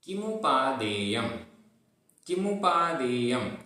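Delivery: किमुपादेयम recited slowly, every syllable clear; slow recitation demo. Shown as a demonstration. Teacher models the Sanskrit word for students. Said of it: "उच्चारण करते हैं".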